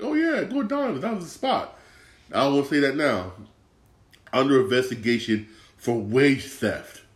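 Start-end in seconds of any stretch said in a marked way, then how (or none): 0.0-1.8 high, bright-eyed hype, much higher pitch; eager recommendation. Retold or imitated. Telling friends to try his old favourite spot, high gushing hype. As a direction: gush like a fan telling a friend where to eat. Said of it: "I'd be like".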